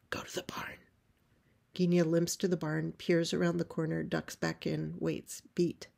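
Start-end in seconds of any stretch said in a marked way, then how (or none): 0.1-0.8 hushed, urgent whispered order; secretive whispered direction; emphatic whisper. Acted. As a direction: whisper it firmly, no voice. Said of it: "emphatic whisper".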